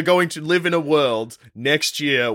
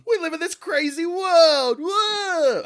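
much higher, wide-eyed goofy awe; goofy Emmett voice; 12 semitones higher, much slower than usual. Retold or imitated. Podcaster imitating Chris Pratt's Lego Movie hero, in goofy awe. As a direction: play a dopey hero amazed at everything. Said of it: "Where he's just like".

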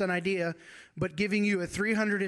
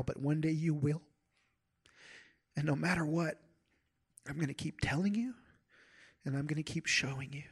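softer, hushed reassurance sinking to a whisper; tender father God; 3 dB quieter than usual. Pretend. God to his little children, softly reassuring, near a whisper. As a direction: soothe a hurting child, quietly. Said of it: "He's saying".

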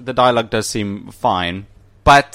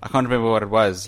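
ordinary delivery

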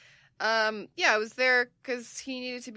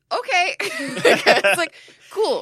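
laughing; 8 semitones higher, 9 dB louder, much slower than usual. Retold or imitated. Mock cheerful reply, laughing.

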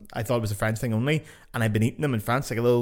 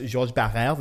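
Georges Barrère in French pronunciation; correct French; slower than usual. Shown as a demonstration. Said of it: "they butcher the fucking pronunciations".

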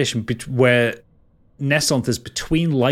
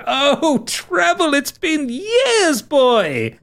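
put-on much higher, slow, delighted old friend; joyful reunion; 13 semitones higher, much slower than usual. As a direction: stretch it out with tearful joy. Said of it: "gives you a tight hug and spins you".

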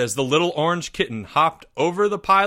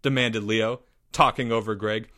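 usual speaking voice

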